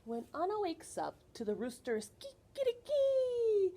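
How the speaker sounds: crowing kikiriki up high; rooster call. Acted. Reading rooster call in story.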